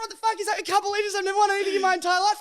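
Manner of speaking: very high, loud squealing disbelief; hammed-up high-pitched shock; retold disbelieving shriek. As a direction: screech it like the luckiest man alive. Mimics boyfriend's disbelief, high.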